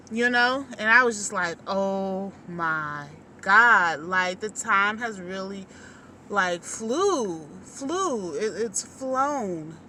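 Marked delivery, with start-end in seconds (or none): 1.7-4.0 very slow, drawn-out amazement; stunned oh my God. Retold or imitated. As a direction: let the realization sink in slowly. Realizing how fast time flew.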